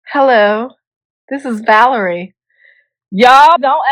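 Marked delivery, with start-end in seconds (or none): 0.1-2.3 phone worker voice. Shown as a demonstration. Phone worker greeting a caller as Valerie, put-on professional voice. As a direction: answer as a phone-line worker. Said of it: "Where my phone workers at?".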